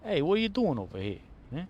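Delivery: lightly put-on Bronx seagull, a bit fast; mild New York Bronx tough guy; Bronx accent. Streamer voicing the seagull, a light Bronx accent. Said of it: "With the Bronx accent".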